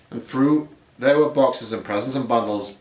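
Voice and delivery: ordinary delivery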